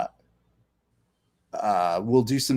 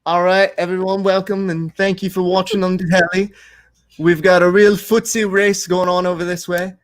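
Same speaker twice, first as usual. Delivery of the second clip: higher-pitched put-on British accent, slipping towards Irish; fictional British commentator; 7 semitones higher, 4 dB louder than usual. Shown as a demonstration. Host welcomes telly viewers, hammed-up British commentator accent. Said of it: "like, uh, a fictional British person would be commentating".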